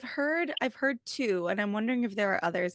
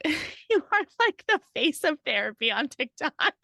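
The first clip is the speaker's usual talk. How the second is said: tickled by the irony, laughing as you say it; high, laughing disbelief; amused, laughing; 7 semitones higher, 4 dB louder than usual. Real, not acted. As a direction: talk through giggles, pitched up.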